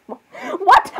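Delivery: very high, falling squeal; shocked. Acted. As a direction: squeal it in disbelief. Shocked at being a Libra.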